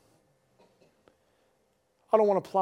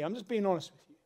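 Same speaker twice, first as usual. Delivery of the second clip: ordinary talk, nothing marked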